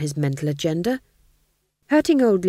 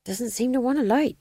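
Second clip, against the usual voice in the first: higher-pitched, unforced mutter; muttering fool; 7 semitones higher than usual. A narrator's reading. Fool failing with matches, higher muttering voice. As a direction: make a feeble excuse, pitched up. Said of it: "muttered the fool".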